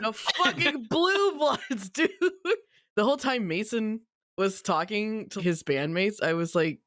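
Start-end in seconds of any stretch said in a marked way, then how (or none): 0.0-2.1 high, very slow, laughing; amused drawn-out laugh; laughing disbelief. Real, not acted. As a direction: savor the ridiculous name. Laughing at band name.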